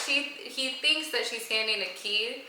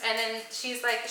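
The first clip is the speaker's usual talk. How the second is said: ordinary talk, nothing marked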